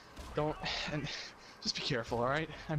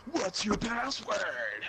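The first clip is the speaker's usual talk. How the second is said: slow, higher drawn-out demand; slow demanding mom; 6 semitones higher, much slower than usual. Retold or imitated. Mom demanding phone password, slow and high. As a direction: stretch it like a stern mother.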